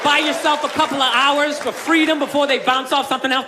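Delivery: ordinary talk, nothing marked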